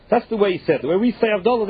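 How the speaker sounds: ordinary delivery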